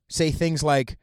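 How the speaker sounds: ordinary talk, nothing marked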